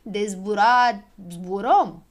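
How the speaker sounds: slow, emphatic grumble; grudgingly concede, dragging the words; grumbling. A narrator's reading. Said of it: "bombăni Don Dănel".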